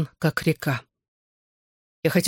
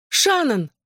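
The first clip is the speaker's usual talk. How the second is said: high, alarmed woman's call; frightened call; 9 semitones higher than usual. A narrator's reading. Mother calls to her daughter down the corridor, frightened. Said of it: "послышался испуганный женский голос".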